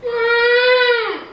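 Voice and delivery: a high whistle through the nose; whistling nose. Real, not acted. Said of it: "she's whistling out of her nose".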